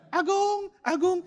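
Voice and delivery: very high squawked "Agung"; parrot imitating. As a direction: copy the call like a cockatoo. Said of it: "Jadi dia lihat saya".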